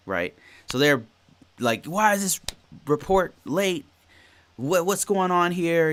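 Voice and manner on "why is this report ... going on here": higher mock-annoyed customer voice, questions pushed up; imitating an angry customer. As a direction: play the irritated client demanding answers. Retelling an angry customer's demands.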